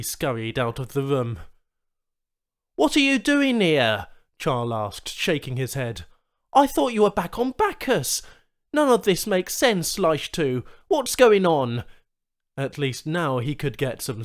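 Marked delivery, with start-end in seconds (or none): 2.8-4.1 startled demand. Acted. Charl confronts Leishtu. 6.5-11.8 agitated. Acted. Charl demands answers.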